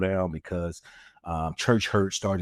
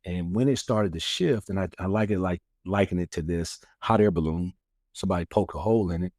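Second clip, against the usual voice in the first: normal talking voice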